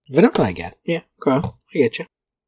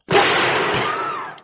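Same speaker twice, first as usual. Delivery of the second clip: censor bleep. Shown as a demonstration. Bleeped swear.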